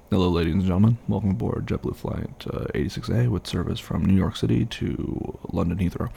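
airline cabin announcement voice; flight attendant. Acted. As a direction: read it like a pilot on the intercom. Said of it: "let me get on the horn right now".

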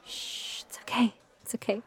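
whispered, soothing shush; soft secretive reassurance; reassuring hush. Acted. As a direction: gently hush a nervous partner. Hushing a nervous partner in public.

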